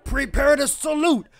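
booming ring announcer intro; arena announcer. Acted. Streamer announcing fighters to the crowd, ring announcer. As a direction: call it out like a wrestling emcee.

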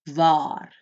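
waar said as vaar with a long A and falling tune; teacher leading the class on waar; Afrikaans waar. Shown as a demonstration. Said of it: "Can you say it with me?".

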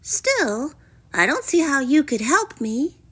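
high, dismissive princess voice; doubtful princess. A narrator's reading.

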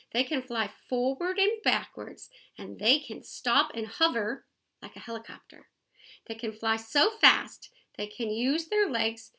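lively picture-book read-aloud; reading to children. A narrator's reading. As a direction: read it out to the kids, bright and clear.

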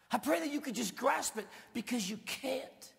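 high, loud pleading; fervent preacher crying out; impassioned cry. Real, not acted. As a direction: reach up with fervent intensity. Impassioned prayer line.

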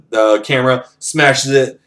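normal talking voice

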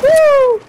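high, loud woo; excited whoop. Real, not acted. Player cheering a close play in game, excited whoop.

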